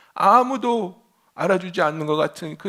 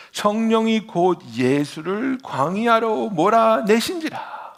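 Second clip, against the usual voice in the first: scripture reading; 6 semitones higher, 5 dB louder, slower than usual. Real, not acted.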